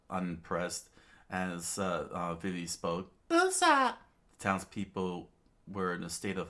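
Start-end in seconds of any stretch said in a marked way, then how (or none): 3.3-4.0 very high, loud cry; high-voiced call. Vivi speaking up to Usopp, high call.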